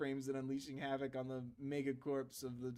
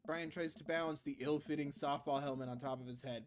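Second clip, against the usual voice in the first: ordinary talk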